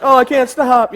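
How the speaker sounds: high, loud mock cry that I can't brake; hammed-up helpless rolling away; mock panic. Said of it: "I'm like".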